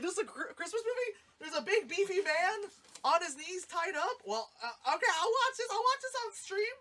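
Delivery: high, loud, thrilled disbelief; hammed-up flustered fan squeal; flustered excitement. Retelling excited shock at screenshot.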